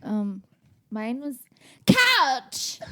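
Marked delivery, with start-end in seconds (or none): 1.9-2.8 loud sharp blurt. Acted. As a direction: belt the word out. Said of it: "Sorry for all the headphone listeners".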